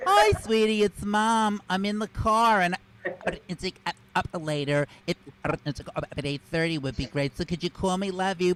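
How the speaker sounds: lightly voiced mother leaving a message; a hint of a chatty mom calling her son; mom on voicemail. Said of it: "listen to her messages like this".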